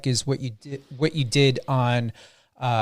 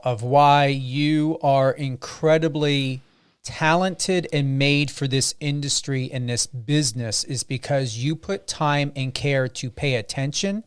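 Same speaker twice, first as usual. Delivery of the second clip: ordinary talk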